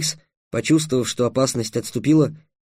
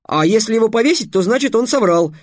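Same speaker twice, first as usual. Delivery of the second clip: high, raised, eager reasoning; pitched-up voice, louder as the logic builds; excited explanation; 7 semitones higher, 5 dB louder than usual. A narrator's reading. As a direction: argue it out with mounting excitement. Trix explains his riddle excitedly.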